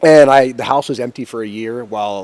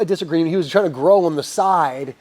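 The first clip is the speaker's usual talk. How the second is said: ordinary talk, nothing marked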